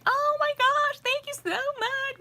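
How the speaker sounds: high, mock-gushing thank-you. Mocking the fans who gush over free treats. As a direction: gush like an overjoyed fan. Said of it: "I don't expect everybody to be like".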